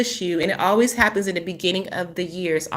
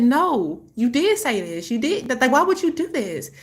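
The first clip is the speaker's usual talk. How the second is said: higher pitched, insistent retold retort; retold insistence; 6 semitones higher than usual. Mother insisting to her ex that he promised, pitch raised. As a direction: push back hard, pitch up. Arguing with ex at door.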